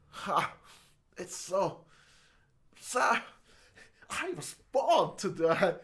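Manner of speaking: halting, oddly paused celebrity impression; Christopher Walken. Shown as a demonstration. Attempted actor impression slipping into another star, halting delivery. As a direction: break it into slow, separate bursts. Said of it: "oh, that was Christopher Walken".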